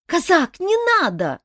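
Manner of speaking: high, loud frightened cry. A narrator's reading. Азель cries out to the Cossack in alarm. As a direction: cry out to stop him in fear. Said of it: "испуганно вскрикнула Азель".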